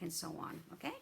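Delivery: normal talking voice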